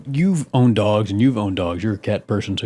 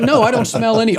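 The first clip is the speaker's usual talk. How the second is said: higher, half-laughing mock innocence; retelling himself; 11 semitones higher, 3 dB louder than usual. Man telling wife he smells nothing, higher mock voice. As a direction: pitch up, playing his clueless self. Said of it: "And I'm like".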